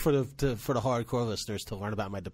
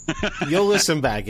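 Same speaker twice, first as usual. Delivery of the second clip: laughing; 5 semitones higher, 7 dB louder, slower than usual. Real, not acted. Laughing while teasing.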